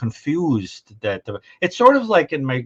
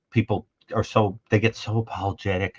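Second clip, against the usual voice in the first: usual speaking voice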